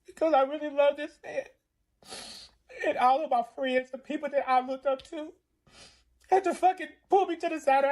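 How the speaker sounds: high, strained real crying; sobbing while telling a painful memory. Real, not acted. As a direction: push the words out through tears. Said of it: "I'm feeling like how I feel now".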